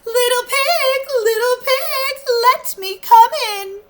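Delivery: high, sugary wolf coaxing; high and sweetly wheedling; sweetest voice. Acted. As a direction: sweet-talk the pig to open the door. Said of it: "in his sweetest voice he called".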